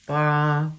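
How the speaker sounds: Ba held high and flat; first tone. Shown as a demonstration. Teacher giving example of first tone, high flat Ba. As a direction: sustain Ba loudly on one high level pitch. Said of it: "That's that high flat tone".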